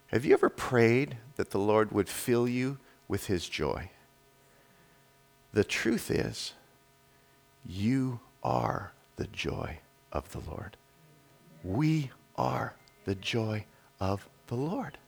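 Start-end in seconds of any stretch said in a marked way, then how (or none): 5.5-15.0 hushed solemn declaration. Real, not acted. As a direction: let each word settle gently on the listeners. Solemn climax of the sermon.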